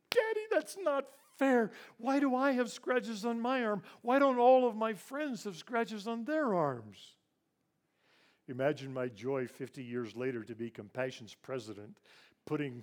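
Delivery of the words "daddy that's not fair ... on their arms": put-on high, loud crying child pleading with his father; tearful child's protest. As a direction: cry out the injustice through tears, like a small boy. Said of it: "my father's face grew blurry through my tears".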